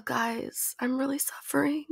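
whiny mock-pitiful whisper; hammed-up sick person; mock suffering. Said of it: "I know, like, "Oh".

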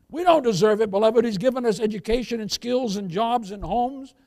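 loud, high, building preacher's cry; preaching at full force. Acted. Preacher rousing the congregation, loud and rising, full force.

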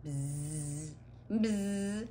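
long, steady buzzing; insect buzz. A narrator's reading. Narrator voices ants flying past Sid, droning buzz. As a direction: drone like a swarm of flying ants. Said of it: "They buzzed loudly".

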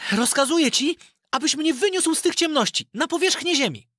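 put-on high boyish voice giving an order; a lad trying out his first command; Aladyn commanding. Aladyn orders the golden spirit to lift him out, high boyish voice. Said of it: "zawołał Aladyn".

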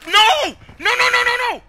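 despairing yell. Real, not acted. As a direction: cry out as you die. Player dies suddenly.

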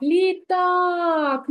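«літак» with the stress on «так», the last syllable held long; stress on второй склад. Shown as a demonstration. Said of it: "на другий склад я поставлю наголос". (наголос на «так» у слові «літак»)

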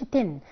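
"تن" with a narrow i, falling; closed-vowel mistake on "تن"; wrong ten, "tin". Shown as a demonstration. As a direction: say "تن" like the word "tin". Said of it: "ما بنقولش تن".

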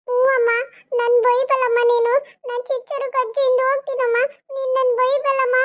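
put-on high, childish whine; whiny little boy. Little boy whining to grandma about school, high childish voice. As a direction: play a small boy refusing to go.

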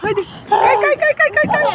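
high, cheering chant urging the slide; excited cheer. Real, not acted.

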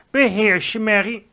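loud, sharp reprimand; curt, loud order; sharp rebuke. Acted. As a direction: snap her out of panic. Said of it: "zei de koningin scherp".

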